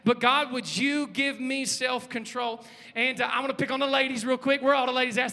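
usual speaking voice

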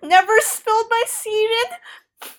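high, slow, strained confession; Lancel confessing. Acted. Host voicing Lancel confessing to Jaime, high and slow. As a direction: squeeze the words out, mortified.

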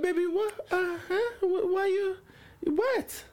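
high-pitched, stammering mum voice; mock-bewildered mother, high and halting. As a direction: voice a baffled mother, pitched well up. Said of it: "And she's like".

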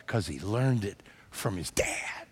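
slow, soft whispered answer. Acted. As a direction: whisper the point home. Hushed sermon punchline.

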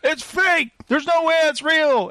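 high insistent heckle; mock scornful protest; heckler in the audience. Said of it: "And he goes".

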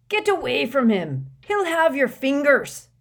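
high, loud bellow; urgent full-voiced shout; shouted warning. A narrator's reading. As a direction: bellow a warning across the yard. Hagrid shouts a warning at visitors.

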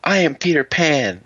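high, slow playful announcement; imitated child proclaiming. Imitating Kaylee holding up stuffed Link, high slow proclamation. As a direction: declare it like a kid playing pretend. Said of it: "Kaylee picked up my stuffed Link and goes".

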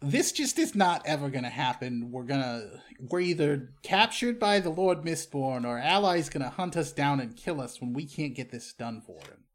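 ordinary talk

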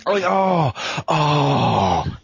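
excited. Real, not acted. Gushing over Spinal Tap idea.

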